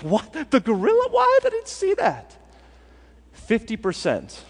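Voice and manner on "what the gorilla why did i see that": high shocked disbelief. Retold or imitated. Viewer who just saw the gorilla, shrieking high in surprise. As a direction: voice jumps up high in astonishment. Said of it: "And you're like".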